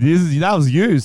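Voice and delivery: loud high accusation; accusing. Real, not acted. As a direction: point the finger, laughing. Accusing friend.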